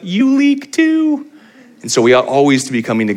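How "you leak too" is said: high, slow and drawn out; playful punchline. Pretend. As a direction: stretch the tag line way up for the joke. Punchline echoing the priest's joke.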